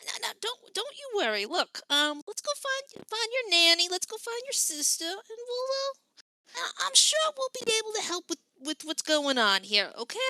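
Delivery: put-on calm the crying boy down gently; soothing auntie.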